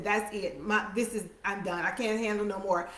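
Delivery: soft, worn-out surrender, a touch of despair; defeated hush. Acted. Preacher voicing David giving up, soft and defeated. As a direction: let the words sink as if giving up. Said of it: "He said".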